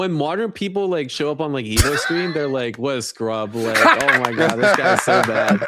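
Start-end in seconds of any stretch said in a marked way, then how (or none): 2.8-5.7 louder mocking quote of disdainful players; mock scorn. Pro player imitates players sneering at modern users, mock scorn. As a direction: jeer at a weak player, half laughing.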